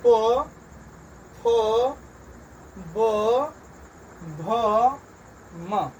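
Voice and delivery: slow, spaced letter-by-letter recitation; teacher reading the alphabet aloud for pupils; letter drill. Shown as a demonstration. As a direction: call out each letter with a pause. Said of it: "नीचे पढ़ते हैं".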